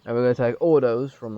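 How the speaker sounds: loud, quick mock decision-making; mock game developers. Streamer mimicking the game's writers borrowing another name, mock-pleased. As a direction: imitate them picking names, loud and brisk. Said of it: "Have they just gone".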